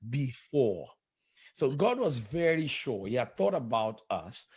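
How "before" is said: "Before" alone, in two deliberate syllables; stress on before. Shown as a demonstration. Preacher repeating the key word for the host, emphatic "Before". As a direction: land firmly on "Before" as a word by itself. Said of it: "emphasis on the word before".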